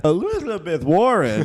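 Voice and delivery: slow, loud mocking announcer; loud, drawn-out mocking newscaster name; mocking Fox News. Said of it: "doing some Indian thing".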